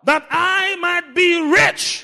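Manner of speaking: high, loud, stretched-out proclamation; full-force preaching. Real, not acted. Preacher making the congregation say rich, full-force preaching. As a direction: rouse the crowd to repeat it.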